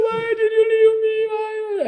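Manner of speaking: very high, keening wail; hammed-up lament at the crematorium; mock wailing mourner. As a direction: wail like a grieving relative.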